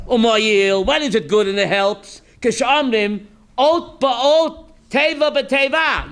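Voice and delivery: normal talking voice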